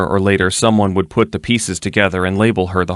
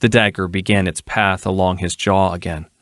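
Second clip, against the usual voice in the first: ordinary delivery, nothing marked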